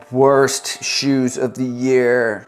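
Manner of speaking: drawn-out, overdramatic announcement; mock dramatic. Host announcing the worst shoes of the year, mock dramatic. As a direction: stretch it slowly for mock drama. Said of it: "so dramatic".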